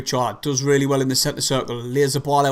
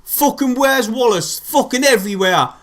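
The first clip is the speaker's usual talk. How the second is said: angry rant; 7 semitones higher, slower than usual. Real, not acted. Fan swearing at the conceded goal.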